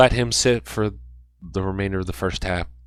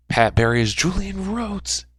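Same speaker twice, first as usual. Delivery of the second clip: high-pitched hyped call; excited; 9 semitones higher than usual. Real, not acted. Hyped commentary on a big play.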